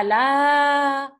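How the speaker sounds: la as one long, loud vowel; long a sound. Shown as a demonstration. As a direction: stretch la into a sustained "laaa". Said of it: "la plus a".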